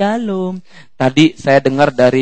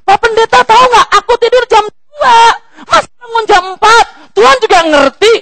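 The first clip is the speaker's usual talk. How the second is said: high, loud, indignant put-on congregant voice; mock whiny complaint; 11 dB louder than usual. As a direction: complain shrilly to the pastor about waking early.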